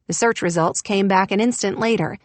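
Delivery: plain reading voice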